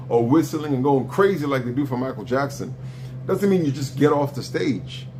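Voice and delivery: ordinary talk, nothing marked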